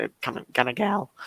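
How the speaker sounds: words barely getting out, voice failing; voice giving out. Real, not acted. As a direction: voice cracking and fading away. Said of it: "I can't speak anymore".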